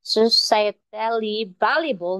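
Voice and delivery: ordinary talk, nothing marked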